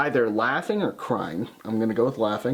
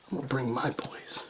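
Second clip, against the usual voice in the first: hushed menace; 2 semitones lower, 10 dB quieter than usual. Retold or imitated. Retelling a wrestler's taunt to a co-host, in a whisper. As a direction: drop to a whisper for the threat.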